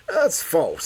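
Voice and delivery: sharp, high, loud denial; sharp, unusual tone. A narrator's reading. Old Craven snapping at Loveday, sharp and high. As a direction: bite off the denial, sharp and strange. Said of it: "with a sharp, unusual sort of tone".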